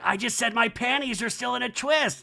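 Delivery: high-pitched mock insistence; lightly exaggerated high impression; Kyle. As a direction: declare it high, as an imitation. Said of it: "And Kyle's like".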